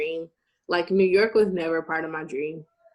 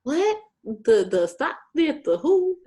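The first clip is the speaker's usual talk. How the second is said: high, louder stammering confusion; baffled confusion; 7 semitones higher, 5 dB louder than usual. Retold or imitated. Stammering at the interviewer, loud and confused. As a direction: stumble over the words, lost. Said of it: "So I'm like".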